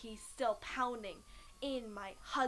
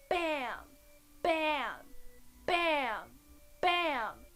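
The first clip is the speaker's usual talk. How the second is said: loud, high, punched bams; violent banging imitation; 3 semitones higher, 6 dB louder, much slower than usual. Storyteller imitating a head hitting the car, punched loud bams. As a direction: slam each word out separately.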